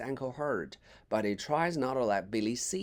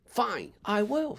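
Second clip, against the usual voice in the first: angrily; 9 semitones higher, slower than usual. A narrator's reading. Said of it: "says Jack angrily".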